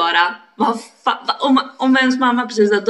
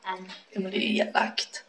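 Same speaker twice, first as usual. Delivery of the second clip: whispered guilty admission; soft conspiratorial whisper; 12 dB quieter than usual. Real, not acted. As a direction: hush it like a confession. Guilty aside.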